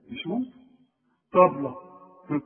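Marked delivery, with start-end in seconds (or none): 1.3-1.8 طبلة loud, with a heavy ط; طبلة with a thick ط; emphatic ta. Shown as a demonstration. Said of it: "مفخمة".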